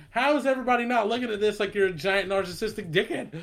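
incredulous laughing rant. Retold or imitated. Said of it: "And I'm like".

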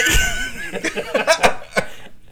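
high breathless laugh; helpless laugh. Real, not acted. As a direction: collapse into laughter. Breaks up laughing.

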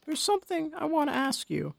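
put-on high, soft old woman voice; a soft, hesitant old lady. A narrator's reading.

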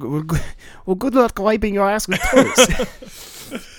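high, slow, scornful jab at the toys; mock angry French guy. Podcaster mocking the French guy, in a high, slow sneer. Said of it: "I was gonna be like".

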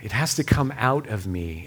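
ordinary delivery

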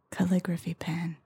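soft unvoiced whisper. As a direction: whisper it close. Whisper round of the game.

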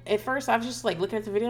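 ordinary talk

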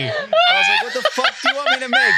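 high, loud, laughing disbelief; incredulous laughing yell; laughing shout. Real, not acted. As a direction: shriek it through laughter. Retelling incredulous dream reply.

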